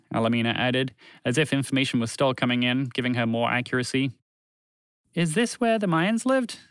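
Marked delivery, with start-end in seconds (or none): 5.1-6.6 higher-pitched, naive asking; wide-eyed innocent query; innocent question. A narrator's reading. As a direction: ask innocently, lifting the pitch. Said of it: "asked Montgomery the Moose innocently".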